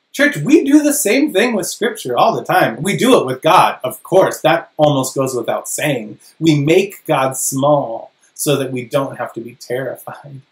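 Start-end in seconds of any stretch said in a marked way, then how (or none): none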